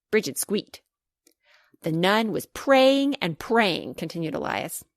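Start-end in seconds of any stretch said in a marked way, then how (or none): 1.8-3.9 slow and dramatic. Acted.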